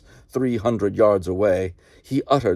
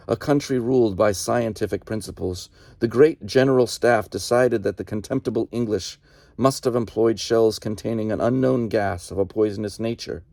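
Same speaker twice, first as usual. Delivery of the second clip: plain reading voice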